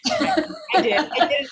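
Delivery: sheepish laughing "I did"; laughing confession. Real, not acted. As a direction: giggle out the admission. Confessing name mix-up, laughing.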